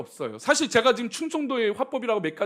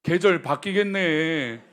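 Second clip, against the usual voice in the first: Chungcheong dialect; 2 semitones lower, 3 dB louder, much slower than usual. Retold or imitated. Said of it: "그 돌려서 말을 합니다".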